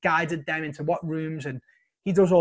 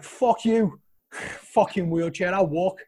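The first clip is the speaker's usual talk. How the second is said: retold defiance; 3 semitones higher, 3 dB louder, much slower than usual. Retold defiant refusal of wheelchair.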